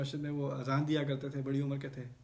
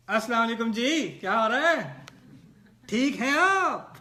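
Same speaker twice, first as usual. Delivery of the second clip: louder, higher mock booming hello with a laugh; loud booming greeting; 6 semitones higher, 9 dB louder, slower than usual. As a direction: greet someone in the mosque far too loudly. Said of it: "ان کی عادت کیا تھی؟". (بلند اور گونجدار آواز میں سلام)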